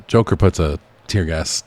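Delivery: ordinary delivery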